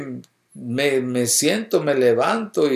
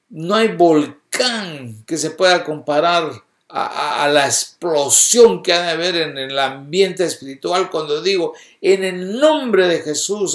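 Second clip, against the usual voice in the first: raised, emphatic preaching voice; impassioned preaching; 5 semitones higher, 5 dB louder than usual. Real, not acted. Preacher to the congregation on the power of Jesus' name, impassioned. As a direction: preach it with force, stretching the key words.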